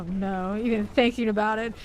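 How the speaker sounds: ordinary talk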